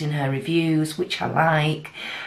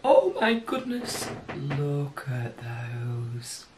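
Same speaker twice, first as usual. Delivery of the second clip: delighted gasp; 2 semitones lower, slower than usual. Real, not acted. Delighted by the bright swatch.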